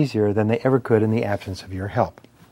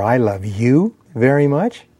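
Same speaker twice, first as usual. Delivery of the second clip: slow, with "you" pushed up and drawn out, rising end; stress on YOU; slower than usual. Shown as a demonstration. Speaker showing how emphasis changes meaning, stress on "you". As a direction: punch "you" like a question. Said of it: "put the intonation or the emphasis on the words".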